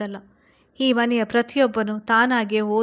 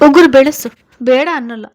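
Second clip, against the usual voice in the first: much louder and higher, scolding; angry outburst; 4 semitones higher, 14 dB louder than usual. Acted. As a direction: burst out at her, then settle. Husband scolding wife over nails. (ಜೋರಾಗಿ ಗದರುವ ಗಂಡ)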